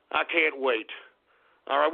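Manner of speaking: ordinary talk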